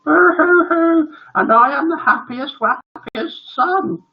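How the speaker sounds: high, slow mock blubbering; boy bursting into tears. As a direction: wail happily like an overcome child. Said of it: "boy burst into tears too".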